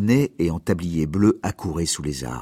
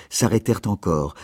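ordinary delivery, nothing marked

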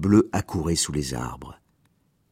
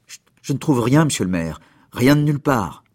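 ordinary delivery, nothing marked

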